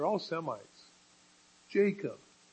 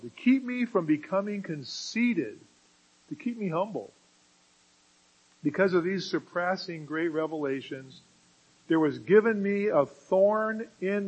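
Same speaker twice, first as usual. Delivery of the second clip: solemn scripture reading; 2 semitones higher, 4 dB louder than usual. Acted. Preacher reads Paul's scripture words.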